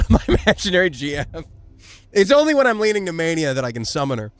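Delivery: ordinary talk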